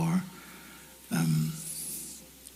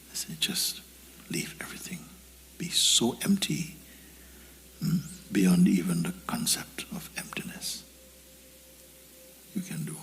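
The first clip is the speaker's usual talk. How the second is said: guided meditation; 6 dB quieter than usual. Acted. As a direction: let each word sink in softly. Guides listeners to empty the mind.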